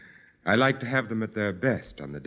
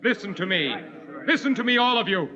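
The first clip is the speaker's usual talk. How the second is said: commanding shout; 12 semitones higher than usual. Acted. Landlord to his rebellious tenants, shouting them down.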